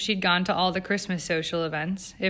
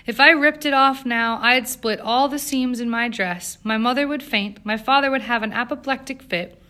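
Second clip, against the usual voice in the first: high, emphatic listing, starting loud; dramatic protest; 5 semitones higher, 6 dB louder than usual. A narrator's reading. Eleanor explaining why her corset stays on, dramatic protest. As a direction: lay out each disaster emphatically, pitched up.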